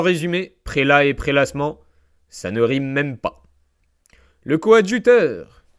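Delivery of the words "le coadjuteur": slow, high, loud title call; grand announcement. Acted. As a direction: announce it like a herald.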